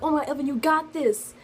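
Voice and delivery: pep her up like a friend; cheering friends. Retold or imitated. Friends reassuring her before results, high and encouraging. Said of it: "they were all just like".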